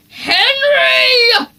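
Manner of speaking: high, loud shouted name; Dad yelling over the music. A narrator's reading. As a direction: bellow across the campsite. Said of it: "Dad yelled".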